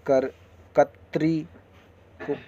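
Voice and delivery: normal talking voice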